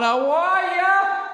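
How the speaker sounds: high, drawn-out sung greeting; singsong hello. Acted. Host calling hello into an echoing attic, high singsong. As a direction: croon it up into the rafters, slow and high.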